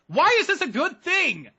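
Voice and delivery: squeaky indignant mock question; high mocking heckler. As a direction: pitch up, loud, scoffing.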